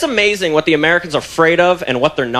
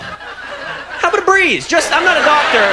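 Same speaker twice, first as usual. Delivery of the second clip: high exasperated plea; 8 semitones higher, slower than usual. Acted. Mock plea about the closed dome.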